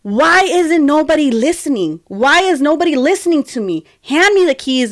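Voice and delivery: high-pitched, frustrated demand; retold yell. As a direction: re-enact yelling to be heard, without full volume. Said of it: "I yell, I'm like".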